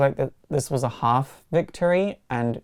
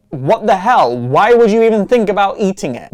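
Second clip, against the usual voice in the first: loud, high-pitched incredulous outburst; exasperated yell, retold with relish; outraged scolding; 6 semitones higher, 11 dB louder than usual. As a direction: act out an appalled telling-off at full volume. Acted scolding in retold argument.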